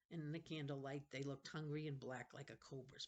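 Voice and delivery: usual speaking voice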